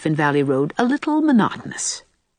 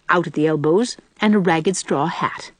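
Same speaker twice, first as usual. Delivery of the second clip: usual speaking voice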